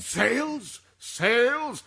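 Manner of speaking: high-pitched incredulous echo; aghast, voice leaping up; scornful disbelief. Acted. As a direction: repeat it as an appalled question.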